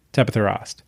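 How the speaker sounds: ordinary delivery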